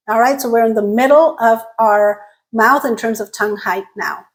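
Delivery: ordinary talk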